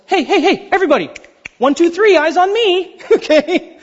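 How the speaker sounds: high, sing-song teacher calling class; kindergarten teacher. Pretend. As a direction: call the kids to attention, bright and high. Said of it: "This isn't that".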